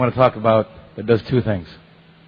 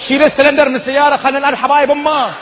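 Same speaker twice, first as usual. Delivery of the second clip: high, fast, furious yelling; angry Bahraini shout; 11 semitones higher, much faster than usual. Retold or imitated. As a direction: yell threats fast in Bahraini dialect. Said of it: "the next guy comes out of the car and goes".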